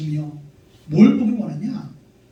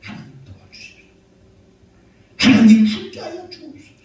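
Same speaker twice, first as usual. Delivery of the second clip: from a hushed plea to a loud cry; swelling prayer; prayer growing loud; 5 dB louder than usual. Shown as a demonstration. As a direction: start under the breath, then cry out to God. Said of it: "목소리가 커지게 되는 거예요".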